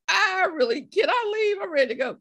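high, loud, urgent pleading; eager plea. Retold or imitated. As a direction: beg comically to be let go. Acting her plea to planner.

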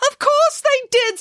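high, slow pig voice; Nanny Piggins voice. Acted. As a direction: squeal it out slowly as a pig.

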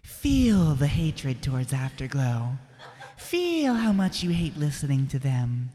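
slow, drawn-out hypnotic delivery; mock guided meditation. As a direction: intone spite as if soothing them. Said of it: "That was a nice little meditation".

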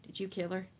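a hushed, careful question to the man he suspects; soft question. Acted. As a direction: ask it gently and quietly. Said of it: "then softly asked".

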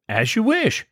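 a hint of the grand old mother giving in; imitating Mama. Host quoting Mama agreeing to the small wedding, lightly mimicking her. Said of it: "Mama tells them".

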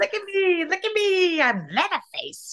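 imitating a squeaky cartoon; cartoon character voice. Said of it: "he sounded like a cartoon character".

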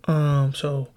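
ordinary delivery

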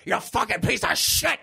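overdramatic cop line. Retold or imitated. Cop on the floor cursing the killer, over-dramatized snarl. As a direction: overact it like a bad B-movie death scene. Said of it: "in the full range that he says it".

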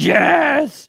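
high, loud, drawn-out cheer; retold elated shout. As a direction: shout it out in triumph. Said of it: "I was like".

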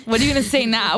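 normal talking voice

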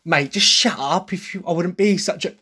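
light Cockney Essex twang on the quote. Retold or imitated. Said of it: "the Cockney Essex twang added into that".

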